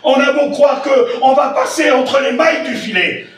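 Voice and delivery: rising fervent shout; preaching shout. Acted. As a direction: call it out to the church.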